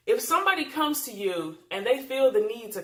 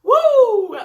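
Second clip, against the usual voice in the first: loud whoop; 14 dB louder than usual. Real, not acted. Laughing at husband's culture.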